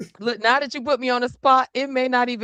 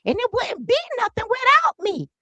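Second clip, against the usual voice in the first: high, boastful queenly put-on voice; Frederica Wilson; 12 semitones higher, 4 dB louder than usual. Congresswoman boasts she started it all, high imperious impression. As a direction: claim all the credit in a high, grand voice. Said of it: "I have been doing an impersonation".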